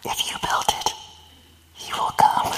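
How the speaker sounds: hushed, breathy voice from the corn; mysterious whisper. Retold or imitated. The voice in the corn calls to Ray, whispered. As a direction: whisper it like a ghostly voice on the wind. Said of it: "and then he hears".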